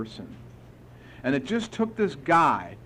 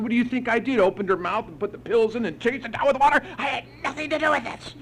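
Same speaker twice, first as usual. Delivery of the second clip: high, defensive outburst; retold indignant protest; 8 semitones higher, 3 dB louder, faster than usual. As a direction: push back, offended. Defensive retort to the sponsor.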